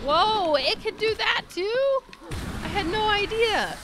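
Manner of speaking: high, loud astonished outburst; loud, high-pitched disbelief; startled amazement. Real, not acted. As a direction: shout it in sheer surprise. Shocked by a game enemy's move.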